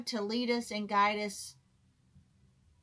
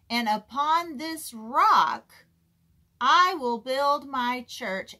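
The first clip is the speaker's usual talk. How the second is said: preaching; 6 dB louder, slower than usual. Acted. Preacher proclaims scripture aloud.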